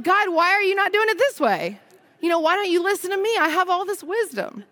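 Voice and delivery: high-pitched mock whine at God, partly fast; mock whiny complaint. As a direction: play the petulant child demanding answers.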